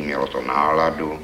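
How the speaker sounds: plain reading voice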